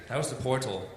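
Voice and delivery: normal talking voice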